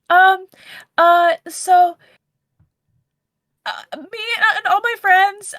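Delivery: put-on high, shaky young girl voice; high tearful girl. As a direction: speak high like a flustered student. Player holds character voice.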